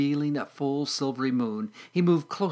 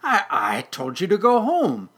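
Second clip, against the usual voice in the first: loud, raised, spluttering protest; scold, rising in exasperation; angry; 7 semitones higher, 7 dB louder than usual. Acted. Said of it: "he said angrily".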